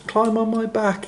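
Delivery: very high squeaky giraffe voice; high-pitched gentle animal squeak. A narrator's reading. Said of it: "he squeaked".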